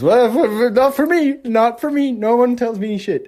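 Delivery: high, sputtering mock defiance; mock indignant comeback. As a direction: protest in a hammed-up high voice. Answers imagined critics in mock defiance.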